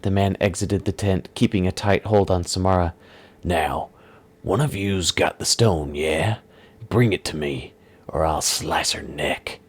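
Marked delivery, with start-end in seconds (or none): 3.4-9.6 low, slow gruff threat; menacing villain. Acted. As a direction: lean on the threat like a thug. Villain demands the stone.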